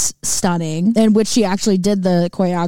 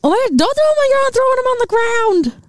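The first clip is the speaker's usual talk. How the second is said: high excited protest; alarmed high exclaim. Real, not acted. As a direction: squeal out a sudden objection. Stickers being thrown on floor.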